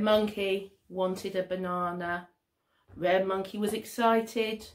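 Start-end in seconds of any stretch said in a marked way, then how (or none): none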